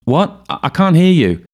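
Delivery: very high, loud straining call; shouting back. A narrator's reading. Ailsa calling into the fissure, can't hear, high shout.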